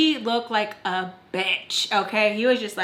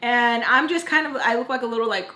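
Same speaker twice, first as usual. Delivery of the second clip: usual speaking voice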